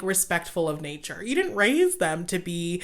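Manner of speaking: ordinary talk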